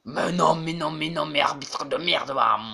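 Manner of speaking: loud furious protest at the referee; angry protest. Real, not acted. Gamer cursing the referee, loud angry protest. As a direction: blast the ref in anger.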